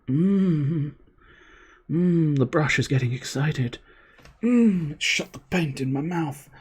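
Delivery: high hummed "mm", hammed-up sultry; mock seductive. Streamer joking about licking the paint brush, in a high mock-seductive voice.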